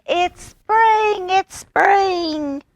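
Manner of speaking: it's spring squeaked very high; very high frog shout. A narrator's reading. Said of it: "he shouted".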